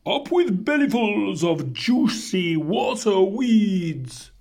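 high-pitched hooted cheer; hooting duck-billed dinosaurs. A narrator's reading. Herd cheering for its favourite food, high hooting. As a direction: hoot it like a happy herd chant. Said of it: "and they hooted".

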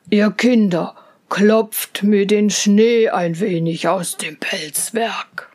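put-on a big kind bear calming the children; gentle bear.

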